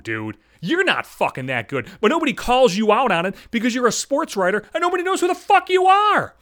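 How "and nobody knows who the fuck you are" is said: high, loud yell; angry yell. Real, not acted. Yelling at a nobody sports writer, high and loud. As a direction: blow up on the last words.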